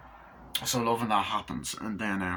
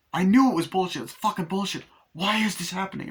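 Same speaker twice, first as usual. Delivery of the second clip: high, loud start to a mock rant; retold exasperated tirade, pitched up; indignant outburst; 9 semitones higher, 4 dB louder than usual. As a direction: protest in acted outrage. Acted outburst at failed ritual.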